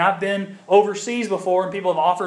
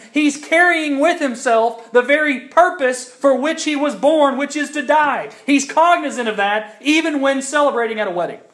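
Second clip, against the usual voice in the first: higher and louder, swelling preacher's build; preaching at full force; 7 semitones higher, 3 dB louder than usual. Real, not acted. Pastor preaching to the congregation about Jesus' purpose, rising fervor. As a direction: drive the point home to the congregation.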